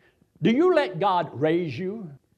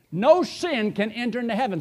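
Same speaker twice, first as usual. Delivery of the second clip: ordinary talk, nothing marked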